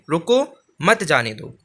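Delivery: "रुको" alone, then "मत जाने दो" falling away; pause after रुको. Shown as a demonstration. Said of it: "ध्यान से आप खुद बोलेंगे".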